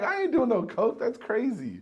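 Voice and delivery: high, loud no-way refusal; emphatic refusal. Retold or imitated. Refusing coke, astonished.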